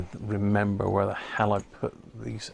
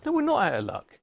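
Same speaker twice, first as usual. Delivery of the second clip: much higher, lightly mocking scold; mock self-scolding; 13 semitones higher than usual. Man talking to himself about his luck, mock high scold. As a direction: tell yourself off in a silly high voice.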